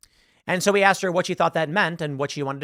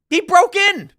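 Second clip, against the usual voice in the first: high, loud burst; yelled, pitched way up in exasperation; exasperated shout; 9 dB louder, slower than usual. Pretend. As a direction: shout it as the obvious thing to say. Exasperated model of what to say.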